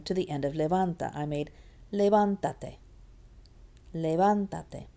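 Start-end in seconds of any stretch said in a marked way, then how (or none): 1.9-2.8 "levántate" as le-VAN-ta-te; stress on VAN. Shown as a demonstration. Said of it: "It's still on the van". 3.9-4.9 "levántate" as le-VAN-ta-te; stress on VAN. Shown as a demonstration. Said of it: "It's still on the van".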